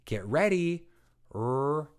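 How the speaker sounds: teacher cue. Shown as a demonstration. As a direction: lift the voice to prompt the child. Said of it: "I'll say".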